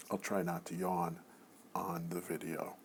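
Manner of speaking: whispered aside, half to herself. Real, not acted. Lecturer to students, trying not to yawn: whispered aside. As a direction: drop to a hushed whisper.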